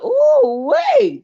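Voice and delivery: high, drawn-out "ooh" of surprise; startled reaction. Retold or imitated. Host retelling her reaction to a near fight, startled. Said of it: "I was like".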